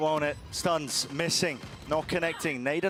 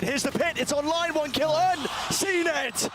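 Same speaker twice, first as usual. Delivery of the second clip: rising, building hype call; excited caster; 9 semitones higher, slower than usual. Real, not acted. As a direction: call the play as it explodes. Caster calls a clutch play.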